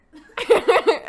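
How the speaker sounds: bursting out laughing; a big loud laugh. Real, not acted. Laughing at own lost voice.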